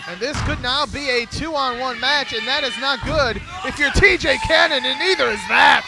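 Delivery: lively ringside play-by-play; wrestling commentator. Real, not acted. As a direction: call the match as it happens.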